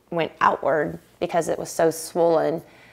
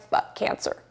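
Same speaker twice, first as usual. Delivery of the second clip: bitter whisper; 6 dB quieter than usual. Real, not acted.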